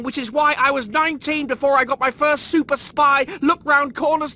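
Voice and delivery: rising to a high, loud shout; building furious rant. Acted. Rimmer ranting at Lister about the academy types, furious. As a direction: let the grievance boil over.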